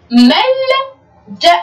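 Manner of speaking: teacher stretching ملجأ; slow model reading. Shown as a demonstration. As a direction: read ملجأ slowly for the class. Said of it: "لاحظوا مع بعض".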